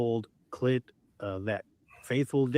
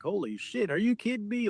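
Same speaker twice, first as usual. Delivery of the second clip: imitated stunned reaction; 9 semitones higher than usual. Host retelling Clint's real-time response to the footage, in mock amazement. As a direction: imitate someone floored, pitch jumping way up. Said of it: "response is probably the funniest thing ever".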